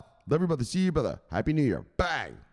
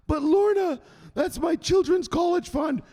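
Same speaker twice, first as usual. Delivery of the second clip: high-pitched mock plea; hammed-up helpless protest, very high; pleading victim; 10 semitones higher than usual. Voices Lorna's victim pleading with her.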